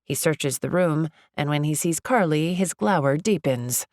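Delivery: plain reading voice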